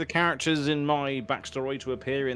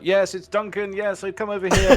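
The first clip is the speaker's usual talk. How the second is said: high, raised call in character; hammed-up high luring call; playing Duncan as bait; 9 semitones higher, 7 dB louder than usual. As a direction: call them over, pitched well up. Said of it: "I'm just gonna just be like".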